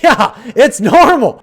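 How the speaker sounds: high, loud laugh-through words; cracking up mid-sentence; incredulous laughing exclamation. Real, not acted. Laughing at absurd post.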